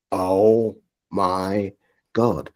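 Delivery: slow, drawn-out, low oh my God; hushed amazement; stunned disbelief. Retold or imitated. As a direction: let the shock land slowly. Realising it is really the BBC.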